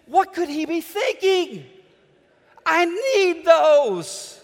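put-on high, loud, dragged-out baby whine; imitating his daughter begging for crackers; indignant toddler. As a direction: plead for the crackers like a desperate toddler. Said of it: "She said".

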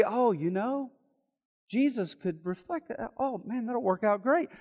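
a hint of sarcastic shrugging; sarcastic mock. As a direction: toss it off as if it were obvious. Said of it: "you say".

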